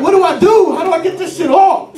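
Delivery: high-pitched frantic begging; mock desperate wail; acted panic. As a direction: plead for help in hammed-up alarm. Panicked plea to sergeant acted out.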